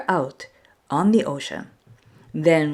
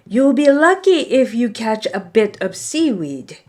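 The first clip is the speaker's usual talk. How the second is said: scoffing; 6 semitones higher than usual. Acted. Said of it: "the brothers scoffed".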